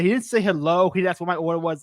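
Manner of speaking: normal talking voice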